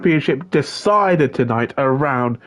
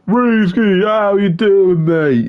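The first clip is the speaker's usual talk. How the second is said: higher, louder, stretched friendly greeting; hyped greeting; 7 semitones higher, 4 dB louder, much slower than usual. Real, not acted. Commentator welcoming his mate, louder hyped greeting. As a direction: keep the hype going on the hello.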